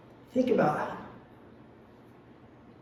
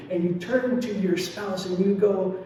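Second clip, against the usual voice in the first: ordinary talk, nothing marked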